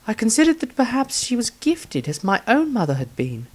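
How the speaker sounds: Rysandria's voice. Acted. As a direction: lift the voice for the young mage writing her diary.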